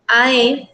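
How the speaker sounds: "A, E" run together as one block, ah-EH; joined vowels. Shown as a demonstration. Teacher merging syllables for poetry counting, vowels joined. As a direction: join "A, E" into a single beat. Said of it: "Las uno".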